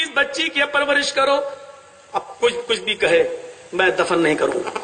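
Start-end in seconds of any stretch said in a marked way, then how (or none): 2.1-4.8 much lower, fast firm promise; resolute father's vow. Acted. Father vows not to bury.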